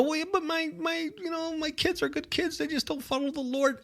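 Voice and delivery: high, soft pleading voice; imitating a listener defending their children; mock defensive parent. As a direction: play a parent excusing their kids, whiny and small. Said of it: "So you may go".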